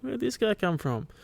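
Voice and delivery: high-pitched, stunned what-the-fuck; shocked surprise. Retold or imitated. Viewer stunned when [name] pops up on screen, pitch leaping high in disbelief. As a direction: jump up in pitch, caught off guard. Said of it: "You're like".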